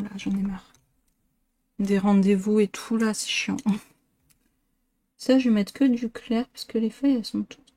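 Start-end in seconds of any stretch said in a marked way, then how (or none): 0.0-0.7 whispering. Real, not acted. Whispered aside about appointments. 3.0-3.6 whispering. Real, not acted. Whispered aside.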